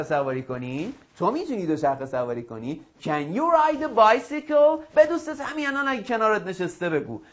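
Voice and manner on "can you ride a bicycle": slow, higher, louder model question; slow drill question. Shown as a demonstration. Teacher models a question to ask a friend, slow drill. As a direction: ask it slowly for learners to copy.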